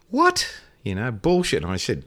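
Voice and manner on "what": sharp "what?" jumping way up; shocked disbelief in one word; incredulous what. Retold or imitated. Traveller told his boards are held, incredulous.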